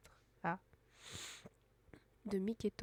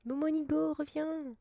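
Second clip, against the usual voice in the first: loud call, pitched higher; 7 semitones higher, 10 dB louder than usual. Real, not acted. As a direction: call after him across the field. Appelle le compagnon au loin. (appel fort et aigu)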